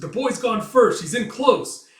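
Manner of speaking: high, loud running commentary; excited onlooker. Acted. Spectator watching the first attack, excited shout. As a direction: call out the action in excitement.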